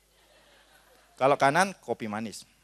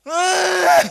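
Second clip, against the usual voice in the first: loud, high frustrated cry; exasperated mock scream; 12 semitones higher, 8 dB louder than usual. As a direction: let out a drawn-out scream of exasperation. Wife frustrated husband misses the hint. (jeritan kesal istri, keras melengking)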